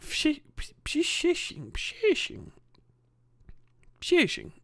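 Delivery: high, hissy "psh" sounds in "shishing". Shown as a demonstration. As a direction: play up the sh of "shishing", pitched high. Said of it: "Lots of shishing".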